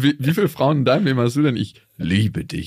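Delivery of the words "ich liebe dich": solemn love vow. Retold or imitated. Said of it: "was für die Ewigkeit".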